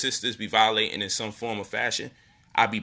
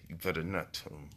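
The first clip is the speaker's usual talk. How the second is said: hushed, much softer secret warning; retold whisper; 8 semitones lower, 15 dB quieter than usual. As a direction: drop to a near whisper, like a threat in the ear. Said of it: "she whispered in my ear".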